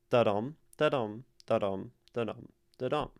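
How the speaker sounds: five "da-dum" beats, each landing on "dum"; five iambs. Shown as a demonstration. Teacher sounding out iambic pentameter, steady iambic beat. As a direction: count out "da-dum" in steady weak-STRONG pairs. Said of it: "it is five iambs".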